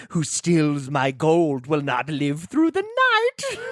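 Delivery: slow, sing-song leprechaun rhyme. Retold or imitated. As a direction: recite it like the cackling leprechaun. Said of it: "please give it to us".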